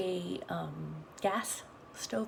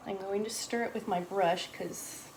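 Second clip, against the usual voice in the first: ordinary talk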